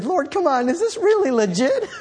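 high pleading disbelief; incredulous prayer. Retold or imitated. Preacher retelling his complaint to the Lord, high and disbelieving. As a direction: ask God as if it can't be true. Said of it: "I said".

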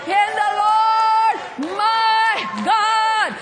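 long, held shouted notes; defiant declaration. Acted. Believer standing up against all of hell, defiant shouted declaration. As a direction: cry it out slowly, stretching each key word. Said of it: "still stand up and say".

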